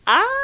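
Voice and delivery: high, loud delighted squeal. Real, not acted. Hearing a fan's fond memory, a loud delighted squeal. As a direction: let out a burst of delight.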